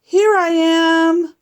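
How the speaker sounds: high, loud, slow call. Pretend. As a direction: call it out across the schoolyard. Daddy arrives, calling out.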